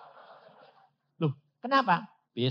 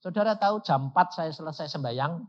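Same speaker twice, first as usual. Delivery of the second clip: usual speaking voice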